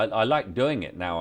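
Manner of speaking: ordinary delivery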